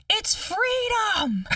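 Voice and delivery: put-on high, very drawn-out little-boy declaration; son yelling. Son answering mom on the bridge, high slow child's cry. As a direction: stretch it out like a thrilled kid. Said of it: "He yelled".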